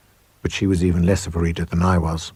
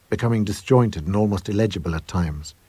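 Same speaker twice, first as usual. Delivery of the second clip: usual speaking voice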